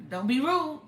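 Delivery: high, loud parental warning; firm mock rebuke from a mother; sharp scolding. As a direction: snap it at the child like a stern mother. Mother scolding child over rudeness.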